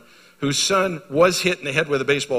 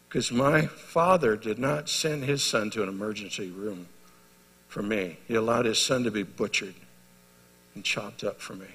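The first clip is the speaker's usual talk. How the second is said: hushed solemn; 4 semitones lower, 6 dB quieter than usual. Real, not acted. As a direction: let the words sink heavily. Solemn climax of testimony.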